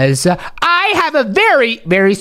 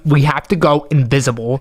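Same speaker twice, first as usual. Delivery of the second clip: ordinary talk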